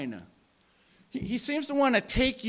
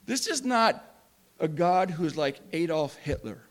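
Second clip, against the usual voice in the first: usual speaking voice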